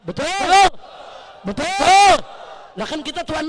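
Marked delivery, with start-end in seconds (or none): 0.1-2.1 loud, high, drawn-out call; rousing call. Real, not acted. Orator asks the rally to agree, rousing shout. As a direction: demand the crowd's answer.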